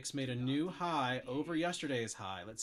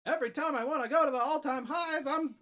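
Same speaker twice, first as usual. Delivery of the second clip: high, nasal-free New York sitcom whine; loud high New York comedian voice; Seinfeld voice; 10 semitones higher, 7 dB louder than usual. Pretend. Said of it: "a little less Seinfeldy".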